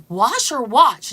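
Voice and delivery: slow, loud "Wash or watch?" checking which; loud, slow "Wash or watch?" asking which she means; questioning. Retold or imitated. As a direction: ask slowly, weighing each word. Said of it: "she goes".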